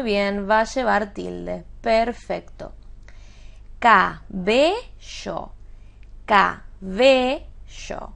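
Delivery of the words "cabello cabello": "cabello" very slowly split as ca, be, llo; syllable by syllable. Shown as a demonstration. Teacher quizzing on word type, "cabello" syllable by syllable. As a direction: sound out "cabello" with pauses, BE stressed. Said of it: "¿qué tipo de palabra es?".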